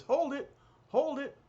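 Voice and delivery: high, urgent call to stop. Acted. Art dealer calling to stop the fight, high call. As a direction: stop them from across the street.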